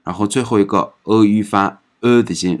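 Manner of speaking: ordinary talk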